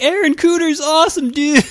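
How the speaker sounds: high, gushing fanboy voice; excited gushing. Retold or imitated. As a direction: rave like a starstruck fan. Fanboy gushing about artist.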